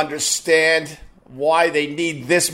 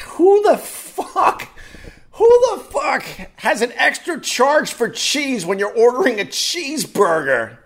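outraged disbelief; 8 semitones higher than usual. Real, not acted. Comic furious at the extra charge for cheese. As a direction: explode at the absurd charge.

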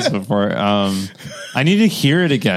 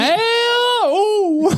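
very high shouted duck greeting; cartoon Donald Duck shout.

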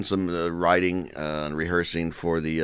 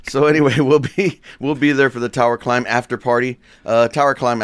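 loud, laughing, pitched-up excitement; excited and laughing; 7 semitones higher, 7 dB louder than usual. Real, not acted. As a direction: burst out with laughing enthusiasm.

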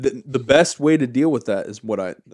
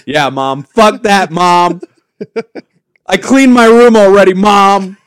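loud, higher whiny yell at mom; bratty teen yell; 9 semitones higher, 14 dB louder, slower than usual. Pretend. Mocking a teenager yelling back at mom. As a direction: shout back like a sulky teenager.

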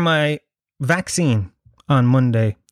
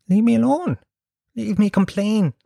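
mock whiny complaint. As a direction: whine it like a spoilt man. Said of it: "do you know what I say to them".

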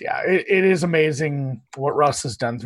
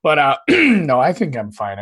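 usual speaking voice

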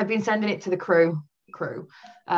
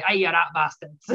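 a deliberately bad Scottish accent, mock-gruff; terrible Scottish accent; 3 semitones lower than usual. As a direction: ham up a dodgy Scottish accent at friends who stare. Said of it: "Terrible Scott".

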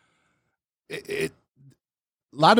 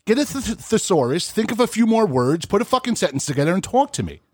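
berating; 8 semitones higher than usual. Retold or imitated. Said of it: "I'm like".

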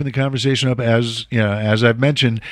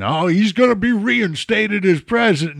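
mocking MAGA voice; 8 semitones higher than usual. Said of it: "maga crowd going".

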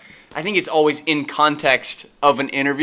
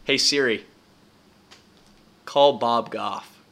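slow clear command; 3 semitones lower, 3 dB quieter, slower than usual. Real, not acted. Command to voice assistant.

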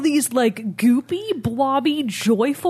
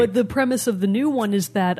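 ordinary talk

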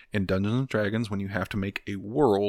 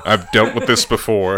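loud pretend reassurance; mock surgeon; 11 dB louder than usual. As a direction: take charge as a make-believe surgeon. Plays cop as emergency surgeon.

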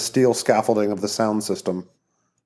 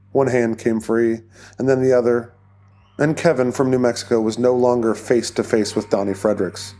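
usual speaking voice

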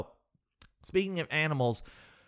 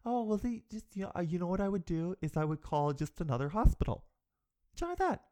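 breezy receptionist; 3 semitones higher, 4 dB quieter than usual. Retold or imitated. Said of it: "they're like".

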